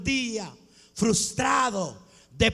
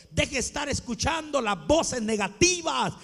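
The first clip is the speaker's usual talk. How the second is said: rebuking exhortation; 3 semitones higher than usual. Real, not acted. Rebuking the congregation, building up. (exhortación que sube hasta el grito)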